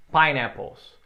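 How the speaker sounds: pineapples said clearly for repeating; model pronunciation. Shown as a demonstration. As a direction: say pineapples slowly, as a model for the class.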